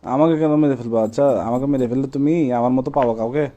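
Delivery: usual speaking voice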